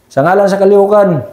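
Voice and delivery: high-pitched mock yell, little added volume; imitated rebel shouting. N P A rebels demanding soldiers surrender, imitated shouting. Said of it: "habang sumisigaw, sir, yung, yung N P A".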